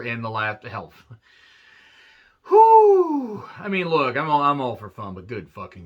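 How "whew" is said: long, high-pitched loud whew; drawn-out high whoop of relief. Real, not acted. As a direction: let out a big sustained high "whoo". Exclaims after praising women's wrestling.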